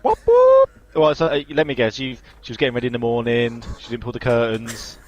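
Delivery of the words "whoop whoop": high, loud teasing whoop; excited whoop. Acted. Teasing his friend about the new neighbour, excited whoop. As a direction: cheer it like a wolf whistle. Hearing the neighbour is a single mum.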